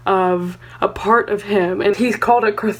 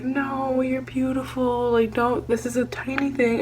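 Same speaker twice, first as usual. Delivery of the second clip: softer, tender cheerleader voice; soft reassurance; 3 semitones higher, 7 dB quieter than usual. Retold or imitated. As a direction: gently comfort him. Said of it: "being his cheerleader and being like".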